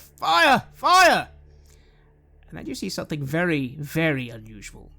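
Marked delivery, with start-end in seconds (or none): 0.0-1.3 high, very loud crowd shout; shouts of alarm. Acted. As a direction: cry out a warning from the street. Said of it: "You can hear shouts on the air".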